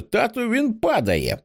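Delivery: put-on high, excited little boy's voice; a small boy calling to his dad; child's cry. A narrator's reading. Little Buster shouts to his father, high and excited. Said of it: "кричить Бастер".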